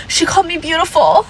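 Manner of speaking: high whiny mock of a confused Karen; high, whiny imitation of a flustered woman. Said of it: "what are they gonna do".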